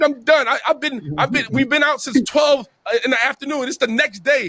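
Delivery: high, worked-up imitation of a complaining pal; exasperated pleading. Tired buddy begging to go home, exasperated high whine. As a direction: protest like you cannot take another hour.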